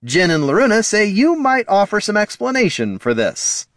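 high, strained politeness at volume; shouting through forced grin. A narrator's reading. Bard to dwarf amid the troll's rampage, forced-polite shout. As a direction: shout politely over the uproar. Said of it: "shouted Heraldon, wearing a forced grin".

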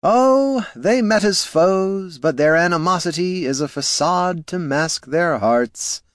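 high, lilting and dreamy, drawn out; airy bard. A narrator's reading. The bard teasing Loruna about love, airy and high. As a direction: float it like a romantic ballad. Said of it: "said the bard airily".

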